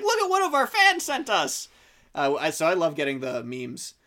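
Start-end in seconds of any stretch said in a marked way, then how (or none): 0.0-1.7 excited. Retold or imitated. Excited quote showing friends memes.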